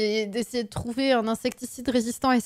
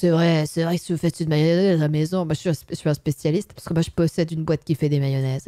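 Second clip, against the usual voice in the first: imitating the expert; 9 semitones lower than usual. Mimics the mayonnaise expert.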